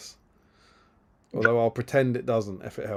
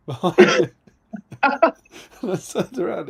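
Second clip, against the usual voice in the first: loud laugh spilling into slow words; giggling as he tells the punchline; 7 semitones higher, 9 dB louder, slower than usual. Real, not acted. Recalling Rob Brydon behind him, laughing loudly. Laughing while telling story.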